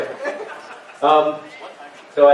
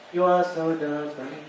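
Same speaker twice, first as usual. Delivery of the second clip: soft echo; 8 dB quieter, slower than usual. Acted. Comedian echoes his own chorus, softly. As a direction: sing it back quietly.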